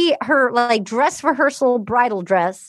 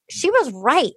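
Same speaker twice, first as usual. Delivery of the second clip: ordinary delivery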